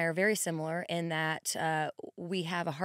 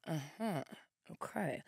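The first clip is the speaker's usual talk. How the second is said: unimpressed doctor; 10 dB quieter than usual. Retold or imitated. Doctor unimpressed by her workout talk.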